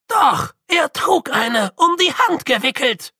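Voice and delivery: put-on old Grütze wailing his protest at the hooded figure. A narrator's reading. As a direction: wail it out in protest. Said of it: "heulte Grütze".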